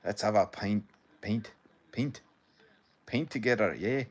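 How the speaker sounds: put-on rough go at a Scottish accent; half-hearted Scottish accent; attempted Scottish accent. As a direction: practise a partial Scottish accent on each phrase. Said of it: "I can't do the full accent".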